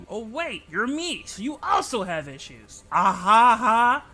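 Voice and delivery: ordinary talk, nothing marked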